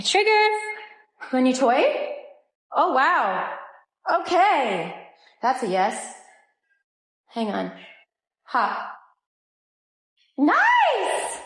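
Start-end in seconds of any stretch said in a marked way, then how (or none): 0.0-0.5 high, louder sing-song for the dogs; pet talk. Real, not acted. Owner offering her dogs a toy and treat in a high pet voice. 10.4-11.4 very high delighted cheer; excited squeal. Real, not acted. Owner cheering as her dog grabs the new toy, very high.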